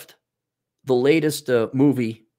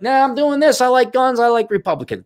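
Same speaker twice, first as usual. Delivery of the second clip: put-on higher, cocky old star sounding off; mouthing off; 10 semitones higher than usual. Aging star mouthing off about politics, cocky and high. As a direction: toss it off like a star who no longer cares. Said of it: "You can mouth off and like".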